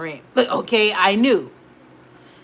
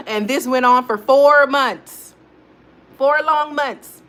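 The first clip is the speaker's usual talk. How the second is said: slow dramatic emphasis; 6 semitones higher, 6 dB louder, slower than usual. Real, not acted. Dramatic emphasis after laugh.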